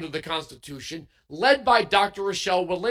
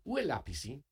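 attempted French; 4 semitones lower, 10 dB quieter than usual. Shown as a demonstration. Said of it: "I can ask for little things like".

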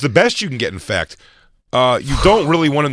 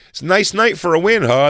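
higher, louder chipper reporter; perky reporter voice; 6 semitones higher, 4 dB louder than usual. Retold or imitated. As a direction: chirp it like a TV reporter. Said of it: "She's like".

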